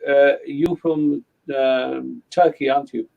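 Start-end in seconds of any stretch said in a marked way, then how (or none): none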